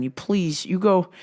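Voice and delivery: normal talking voice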